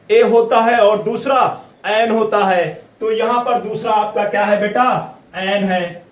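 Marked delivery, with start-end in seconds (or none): none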